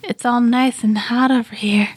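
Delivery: hushed, hammed-up sexy commercial. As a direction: whisper it like a seductive jazz coffee shop ad. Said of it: "I did a sexy voice".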